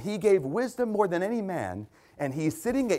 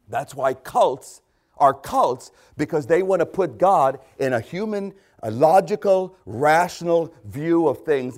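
forceful preaching; 6 dB louder than usual. Acted. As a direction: drive each phrase home hard.

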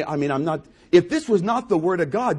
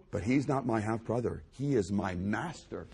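much lower and softer, reverent; humble hush; 7 semitones lower, 8 dB quieter than usual. Acted. Voicing Jude's humble view.

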